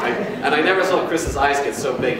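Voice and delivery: normal talking voice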